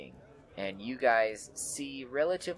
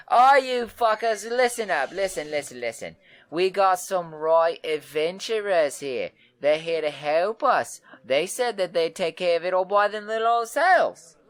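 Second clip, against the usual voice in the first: very high and loud, calling the room to order; rowdy announcing; 9 semitones higher, 9 dB louder than usual. Acted. Red-haired woman shouts the room to attention, very high and loud. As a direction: hush the crowd and brag about the heroes. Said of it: "she walks in and she says".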